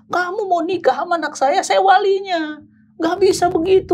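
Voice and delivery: high-pitched angry outburst of a retold father; raging father. Father refusing his daughter's suitor, high-pitched and angry. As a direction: imitate an enraged father laying down the law. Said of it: "bapaknya ngamuk".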